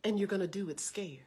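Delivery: hushed, low reassurance; steady them in a near whisper; very calm. Retold or imitated. Big sister encouraging a frightened preacher, calm and hushed. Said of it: "she was so calm".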